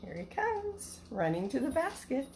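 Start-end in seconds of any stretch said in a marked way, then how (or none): none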